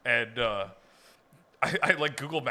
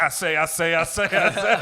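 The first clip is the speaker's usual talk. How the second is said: Foghorn Leghorn; 3 semitones higher, 5 dB louder than usual. Retold or imitated. Imitating the rooster giving directions, blustering. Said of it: "He's like".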